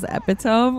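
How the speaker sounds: epitome read as EP-ih-tohm; mispronounced epitome. Retold or imitated. Streamer quoting Michael's mistake, epitome mispronounced as EP-ih-tohm. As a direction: end epitome like "home", as spelled. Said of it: "people have read it but have never heard".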